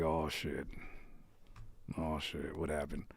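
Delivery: low, hushed oh shit; hushed alarm. Retold or imitated. Streamer imitating his own hushed alarm at awkward chat. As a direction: sink low like caught off guard. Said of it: "I'll be like".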